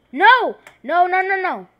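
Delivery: loud, high panicked no's; real shout. Real, not acted. Gamer shouting as she pushes a player, panicked shout. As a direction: yell out in real alarm.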